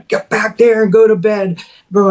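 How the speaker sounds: high, furious shouted order; angry yelling. Retold or imitated. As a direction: bark the kid off to bed. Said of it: "He just starts screaming".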